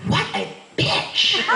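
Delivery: slow, whispered onlooker's aside; muttered insult. Retold or imitated. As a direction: imitate a bystander whispering behind someone's back. Onlooker's muttered remark from behind.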